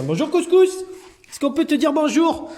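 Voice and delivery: very high, loud greeting to a cat; high pet greeting. Real, not acted. Greeting cat Couscous, very high and loud. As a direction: squeal hello to the cat.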